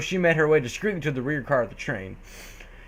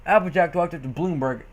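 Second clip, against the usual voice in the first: usual speaking voice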